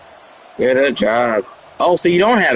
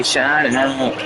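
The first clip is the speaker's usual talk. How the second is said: mock complaining; 4 dB quieter, much slower than usual. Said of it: "I'm gonna complain and say".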